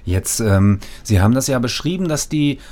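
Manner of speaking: normal talking voice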